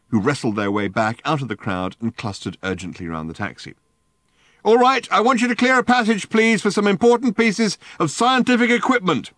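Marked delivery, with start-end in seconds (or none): 4.6-9.3 high, loud megaphone voice; booming megaphone. A narrator's reading. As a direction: boom orders over the crowd. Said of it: "boomed Ford".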